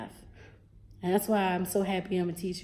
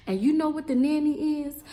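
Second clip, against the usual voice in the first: ordinary delivery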